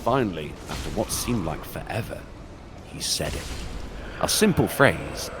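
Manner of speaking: low, hushed horror narration; creepy story narration. Acted. Streamer reading a creepypasta to chat, hushed and ominous. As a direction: spin a scary tale for the viewers.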